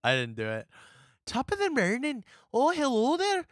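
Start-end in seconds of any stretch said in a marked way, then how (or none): 1.3-3.4 very high, botched Irish brogue; Irish slipping into Scottish. Shown as a demonstration. Host fumbles an accent for his co-host, Irish gone Scottish. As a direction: attempt Irish and slide into Scottish. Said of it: "I, I go Scottish".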